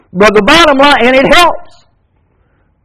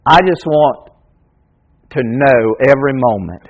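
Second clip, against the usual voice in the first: much softer and lower, tender and unhurried; intimate hush; 9 semitones lower, 5 dB quieter than usual. Real, not acted. Preacher reassuring worried parents, softly and tenderly. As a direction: speak it like a quiet confession.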